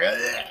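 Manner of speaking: high strangled gurgle; imitated choking noise. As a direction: mimic someone choking in a noose. Imitates Ralph being hanged.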